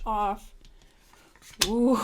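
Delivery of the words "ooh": loud cackling witch laugh; witch cackle. Acted. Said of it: "my little witch cackle".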